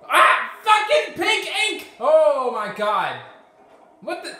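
very high, loud, slow scream; enraged shout. Real, not acted. Gamer hit by pink ink, enraged shout.

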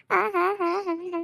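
slow gloating villain laugh; villain laughing at her mother-in-law's illness. Acted.

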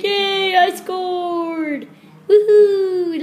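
high, loud celebratory woohoo with a laugh; excited whoop. Pretend. Footballer celebrating his goal, loud excited whoop. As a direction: cheer like you just scored.

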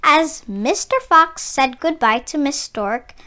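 plain reading voice

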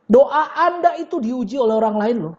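ordinary talk, nothing marked

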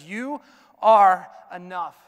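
ENOUGH landed loud and slow; a slow, loud, emphatic "You are enough"; stressed declaration. Acted. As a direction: stretch out and hit ENOUGH. God's words stressed.

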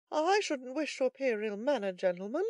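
high, polite character voice; third man. A narrator's reading. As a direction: venture it politely to the gentlemen. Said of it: "said the third".

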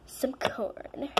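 soft secret whisper. Acted. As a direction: whisper it to yourself. Whispered aside about cravings.

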